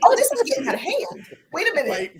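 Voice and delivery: high-pitched, through real laughter; laughing protest. Real, not acted. Co-host complaining the panel is getting out of hand, laughing. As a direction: protest while cracking up. Helplessly amused, calling for order.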